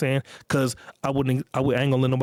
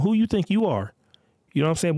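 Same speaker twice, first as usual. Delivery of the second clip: ordinary delivery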